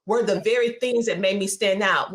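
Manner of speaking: normal talking voice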